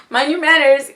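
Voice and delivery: haughty warning. Acted. Saturn warns whoever enters her domain, loud and lofty. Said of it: "You're entering Saturn's domain".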